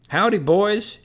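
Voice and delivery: high, loud hayseed drawl of a greeting; a mocking country hayseed accent, hollered; hayseed accent shout. A narrator's reading. Moats taunting the rival team in a loud hayseed accent. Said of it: "he shouted in his best hayseed accent".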